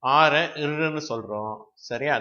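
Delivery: ordinary talk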